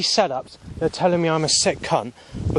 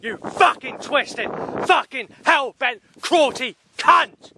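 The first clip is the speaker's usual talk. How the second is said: furious shout; 12 semitones higher, slower than usual. Real, not acted. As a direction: yell the insults, losing control, slowing at the end. Furious rant at unseen persecutors.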